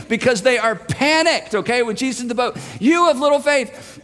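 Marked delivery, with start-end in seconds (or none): 2.8-4.0 loud rebuke. Retold or imitated. Jesus yelling to panicked disciples over storm, loud rebuke. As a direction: shout the rebuke over the wind. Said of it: "He's having to yell".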